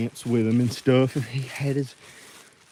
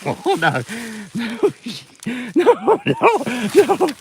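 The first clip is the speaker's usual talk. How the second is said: high, loud laughing no; squealed through laughter; laughing hard; 12 semitones higher, 6 dB louder, slower than usual. Real, not acted. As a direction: crack up while protesting. Cracking up over eating a steak.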